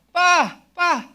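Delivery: short, high, loud shouts; acted urgent call from the roadside; sudden calling out. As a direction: holler for Pa at a passing driver. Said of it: "tapos bigla may tumawag sa'yo".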